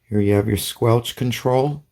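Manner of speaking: ordinary talk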